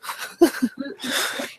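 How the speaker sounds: squeaky breathless giggle; a high airy laugh at his own story; high breathy laugh. Real, not acted. Telling friends the bait-tank story, high breathy laugh.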